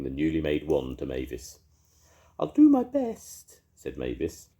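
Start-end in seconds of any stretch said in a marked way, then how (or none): 2.4-3.6 very high, louder fairy voice; comforting fairy. A narrator's reading. Mavis comforting the ice lolly in a high fairy voice. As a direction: soothe him in a tiny high fairy voice. Mavis takes new wand.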